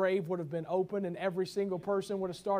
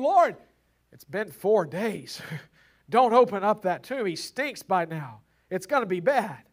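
alarmed protest; 7 dB louder than usual. Acted. Martha protests at the tomb.

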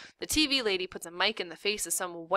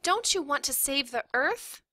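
put-on high-pitched earnest kid; high, righteous little girl; pleading young daughter; 7 semitones higher than usual. A narrator's reading. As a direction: appeal to mom in a child's voice.

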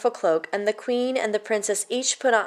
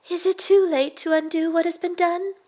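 high, gentle princess; princess's voice; 9 semitones higher than usual. Acted. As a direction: ask hopefully as the princess. Princess asks the boy.

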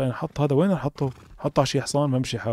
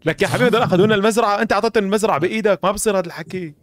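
loud protest; 7 semitones higher, 4 dB louder than usual. Real, not acted. Protesting to a teammate over the farm.

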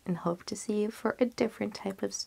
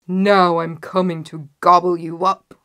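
loud, threatening troll voice; roaring troll; 11 dB louder than usual. A narrator's reading. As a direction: threaten to devour, loudly. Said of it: "roared the troll".

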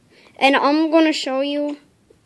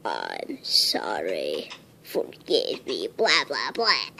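low, slow, bored mock sorry; fake apology; 10 dB quieter, slower than usual. Kid mocks an insincere apology, low and bored. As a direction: drone it like you don't mean it. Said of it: "If I didn't mean it, I'd say".

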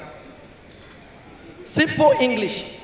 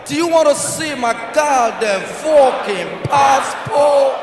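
slow, drawn-out mock American accent; imitating the American; slower than usual. Nigerian traveller copying the American to officers, mock American accent. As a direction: copy the American's indignant drawl. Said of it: "Talk the same way when that guy talk".

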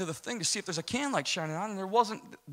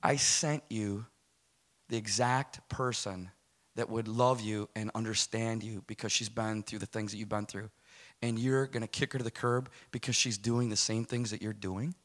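voice of the Holy Spirit; 6 semitones lower, 6 dB quieter than usual. Retold or imitated. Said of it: "the Holy Spirit speaks to me and says".

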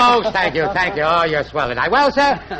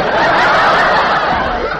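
breathy chuckle; hearty laugh; 7 semitones higher, 3 dB louder than usual. Real, not acted. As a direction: laugh at your own joke. Laughing at his own joke.

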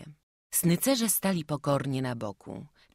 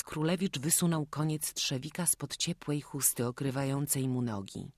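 usual speaking voice

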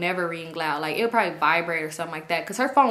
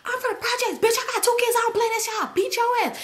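imitated screaming; faster than usual. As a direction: mimic her hollering in a high voice. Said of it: "She used to always scream".

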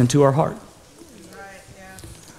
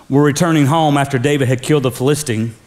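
usual speaking voice

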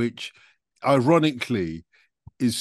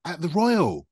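higher-pitched, astonished check; real incredulity; 5 semitones higher than usual. Real, not acted. Host hearing [name] played the local Royal, astonished. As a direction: question it in disbelief, swooping up then down.

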